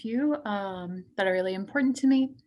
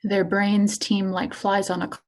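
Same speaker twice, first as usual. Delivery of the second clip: poetry reading. Acted. Poet reading self-mutilation piece to an audience, poetry reading. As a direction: give each image its own phrase. Reads own poem aloud.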